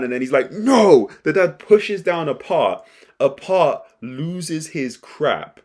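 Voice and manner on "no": high, loud yelled no; father yelling at his son; shouted no. Retold or imitated. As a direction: throw out a sharp refusal shout. Dad refuses to calm down.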